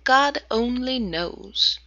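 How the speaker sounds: plain reading voice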